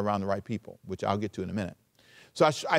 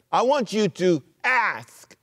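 slow, firm, higher "I want you to ask"; firm command; 7 semitones higher, 3 dB louder, slower than usual. Retold or imitated. Preacher quoting Jesus as a firm order, slow. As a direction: say it with authority. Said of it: "He said".

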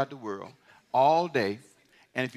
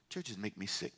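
much lower, much softer, said to himself; inner thought; 9 semitones lower, 15 dB quieter than usual. Pretend. Pastor voicing his private thought to the church, hushed and low. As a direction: confess it under your breath. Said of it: "That's what I'm saying in my head".

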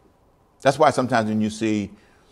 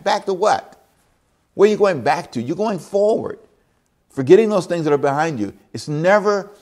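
much higher, incredulous challenge; emphatic preaching; 7 semitones higher, 3 dB louder than usual. Acted. Preacher mocks longing to go back, fervent. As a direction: throw the question back at them.

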